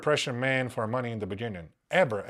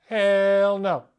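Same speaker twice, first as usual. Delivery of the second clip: emphatic refusal; 6 semitones higher, 7 dB louder than usual. Real, not acted. Rejecting sugar in coffee.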